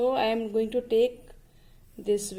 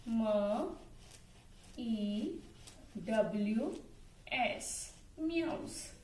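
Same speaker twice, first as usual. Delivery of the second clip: spelling softly; 14 dB quieter, much slower than usual. Shown as a demonstration. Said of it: "A cat meows".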